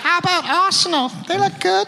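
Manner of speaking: very high mock Cockney greeting; a bad English accent, chirpy ghost; shitty English accent. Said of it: "doing an impression".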